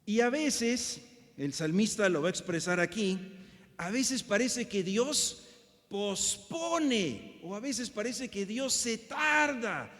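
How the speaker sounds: loud, high-pitched preaching; preaching at full force. Acted. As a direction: preach it out to the whole congregation. Opening preaching to congregation.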